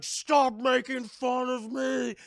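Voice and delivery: slow, loud, high oafish shout; wounded mock yell. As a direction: bellow it drawn out as the mocked brute. [name] yells back at bully.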